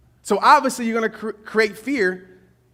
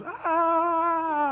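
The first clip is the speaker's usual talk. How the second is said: long, high, soft scream fading away; imitated falling scream; 10 semitones higher, 5 dB quieter than usual. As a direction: mimic a skydiver's distant cry. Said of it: "all I hear is".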